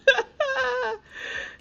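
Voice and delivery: loud, high-pitched cackle of laughter; shrieking laugh. Real, not acted. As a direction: lose it at your own joke. Laughing at her sim's line.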